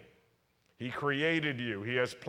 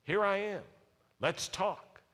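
inviting God's voice; 6 semitones higher than usual. Pretend. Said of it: "God will say".

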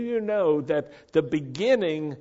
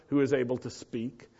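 ordinary delivery

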